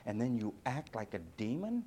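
normal talking voice

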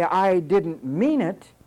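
drawn-out, booming mock excuse; preacher mocking the excuse; slow booming quote. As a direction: thunder the excuse back slowly. Preaching at full force.

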